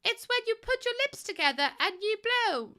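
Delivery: high little cartoon pig explaining; Peppa Pig voice. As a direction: chirp it like a bossy cartoon pig. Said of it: "Peppa Pig".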